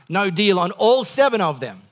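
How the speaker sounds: ordinary talk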